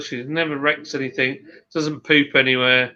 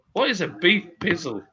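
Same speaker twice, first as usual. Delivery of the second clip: ordinary talk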